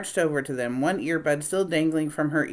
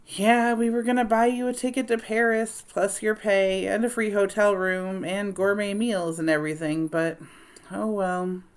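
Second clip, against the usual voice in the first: mock sad; 5 semitones higher than usual. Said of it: "He put on a sad face and sighed".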